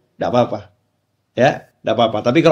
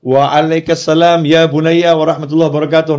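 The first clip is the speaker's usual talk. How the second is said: mother's Arabic reply; 3 semitones higher, 3 dB louder than usual. Retold or imitated. Mother answers from inside.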